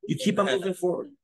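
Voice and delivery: usual speaking voice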